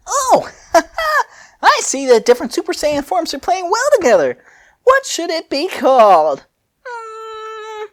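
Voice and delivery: squeaky high musing inventor; high Fu pondering aloud. Acted. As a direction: go way up high and play the giddy scientist. Fu voice held.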